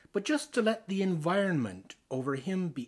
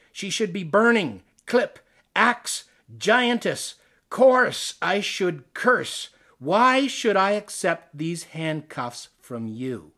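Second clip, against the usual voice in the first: defiant outcry; 2 semitones higher, 7 dB louder, slower than usual. Acted. The speaker rejects the reader's expectations in a loud defiant outburst. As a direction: rise into a defiant rant, then slow and heavy at the end. Poet defies reader's projected image.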